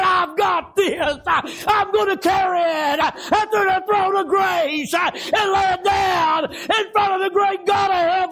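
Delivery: high, sustained revival shout; full-force preaching. Real, not acted. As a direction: cry it out in long, drawn phrases.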